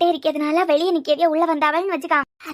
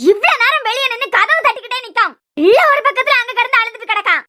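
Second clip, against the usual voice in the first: loud worried complaint; 5 semitones higher, 6 dB louder than usual. Acted. Worried relative outside door.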